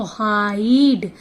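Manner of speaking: हाइड with a slow, loud ai; stretched vowel. Shown as a demonstration. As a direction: draw out the ai in हाइड. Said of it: "आई को आवाज़ ज़्यादा दी गई है". (हाइड में आइ को खींचकर)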